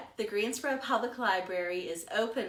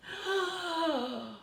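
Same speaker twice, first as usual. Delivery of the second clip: gasp; 9 semitones higher than usual. Acted. Amazed at Plumie's egg.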